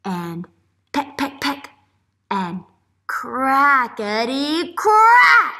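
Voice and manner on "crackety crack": very slow, loud crackety crack; booming storybook crack; dramatic crack. A narrator's reading. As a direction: stretch it out big and loud for the kids. Story read to children.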